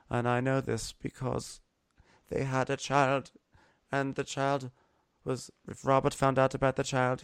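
solemn, halting confession; Jon Snow. Acted. Jon confessing his parentage to Khaleesi, halting and solemn.

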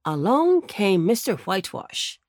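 usual speaking voice

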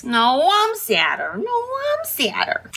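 high, slow whining mock rivalry; mock whiny. Sirius and Harry competing over sadness, mock whine. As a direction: whine like a sulking kid. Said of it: "wanna out sad each other".

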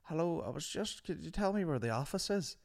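high-pitched imitation of an old man; sad old man. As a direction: ask timidly like a lost old man. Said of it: "this old man came up, and it was very sad".

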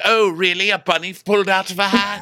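high theatrical tease; mock magician Lisa. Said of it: "She's like".

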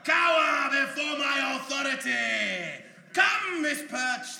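slow loud commanding boast; megalomaniac rant. Acted. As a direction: lord it over the villains. Hero gloats commandingly.

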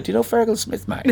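Australian lad. Retold or imitated. Surfer lad asking an Irish visitor, imitated high voice. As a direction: mimic the lad's high-pitched question with "mate". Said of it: "I met this lad".

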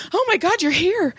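high, overwrought gushing; mock a client nearly hyperventilating with relief; imitated frantic relief. Late-waiting client greets therapist at the door, imitated frantic gushing. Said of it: "She's almost hyperventilating".

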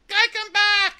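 very high, loud parroting shriek; Yuki. Retold or imitated. Yuki repeats words she doesn't understand, shrieked parroting. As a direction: echo it back in a squeaky cartoon voice. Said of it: "she's just repeating words".

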